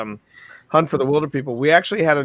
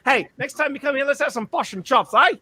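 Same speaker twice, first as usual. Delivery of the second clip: high, hammed-up New Zealand accent; [name]'s thickened accent; 6 semitones higher than usual. [name] saying goodnight to Dave, thick New Zealand accent. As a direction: lay on a thick, lively Kiwi accent. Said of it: "her accent getting a little thicker".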